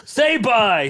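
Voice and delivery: high, loud playful order; mock-demanding shout. Host ordering laughing co-host to sign off, playfully shouting. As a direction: call it out as a pushy command.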